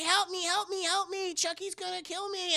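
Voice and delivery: very high, mock-frantic kid voice; panicked child pleading. Tyler fleeing from Chucky, panicked child pleading. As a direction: beg repeatedly in a high little voice. Tyler runs back begging, retold.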